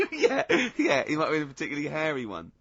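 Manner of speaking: high, loud burst of laughter into words; laughing. Real, not acted. Laughing at his mate's alopecia joke, loud and high. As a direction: laugh through it, then rush the words out.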